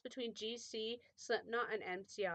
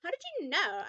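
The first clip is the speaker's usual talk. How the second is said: high-pitched surprised question; 7 semitones higher, 4 dB louder than usual. A narrator's reading. Narrator questioning Voldemort, surprised. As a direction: ask it with a startled jump in pitch. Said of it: "in a surprised way".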